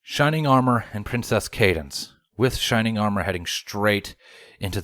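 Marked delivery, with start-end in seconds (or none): none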